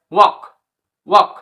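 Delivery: ওয়াক with silent l, aw vowel then k; walk demo. Shown as a demonstration. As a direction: say ওয়াক twice, no l sound. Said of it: "সঠিক উচ্চারণ কি হচ্ছে".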